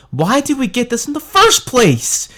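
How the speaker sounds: high, loud indignant question; incredulous rant; outraged. Real, not acted. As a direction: demand to know why, exasperated. Ranting rhetorical question.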